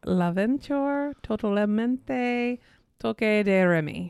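slow, careful fake Spanish accent; Spanish-sounding accent. Shown as a demonstration. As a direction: sound out the foreign title slowly. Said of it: "Do your best".

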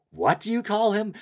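high, put-on voice for the quote; character voice. Character questioning the kneeling Preacher, in a high put-on voice. German character's line acted out.

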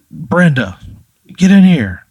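upset yelling. Acted. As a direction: yell for your wife to come at once. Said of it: "very upset and freaking out".

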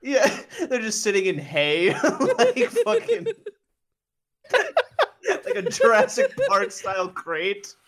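high breathless laughing words; slowed, shrieking through laughter; laughing while talking. Real, not acted.

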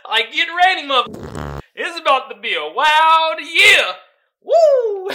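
loud excited yell; hyped shout. Retold or imitated. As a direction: hype it up like a warning. Hyped announcement of wild year.

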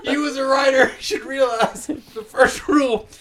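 high, breaking with laughter; laughing through words. Real, not acted. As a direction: try to speak while cracking up. Mock lecture, cracking up.

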